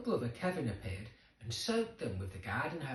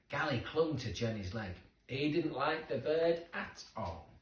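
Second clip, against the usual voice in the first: plain reading voice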